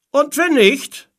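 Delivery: high, loud shouted question; calling back. A narrator's reading. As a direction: shout back from the other ship. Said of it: "tönte eine Stimme von dem anderen Schiff zurück". (laut zurückgerufen)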